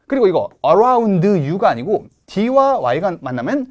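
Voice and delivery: ordinary delivery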